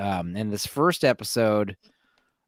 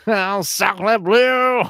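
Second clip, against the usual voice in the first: put-on over-the-top French accent, laughing; hammy French chef exclamation; 10 semitones higher, 7 dB louder, much slower than usual. Mock French after saying Paris.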